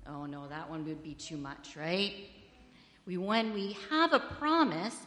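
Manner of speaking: usual speaking voice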